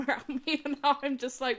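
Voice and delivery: ordinary delivery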